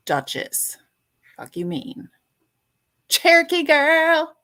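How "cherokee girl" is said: high, loud, slow drawn-out hello; excited greeting. Real, not acted. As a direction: call the name out with delight.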